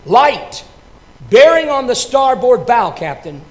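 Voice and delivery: loud, high call of a sighting; lookout's call. Acted. Lookout reporting a light to the captain, called out loud. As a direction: call it out across the deck to the captain. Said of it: "the lookout on the wing reported".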